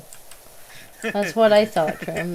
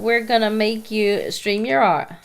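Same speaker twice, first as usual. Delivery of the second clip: usual speaking voice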